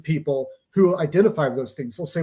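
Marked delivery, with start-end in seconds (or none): none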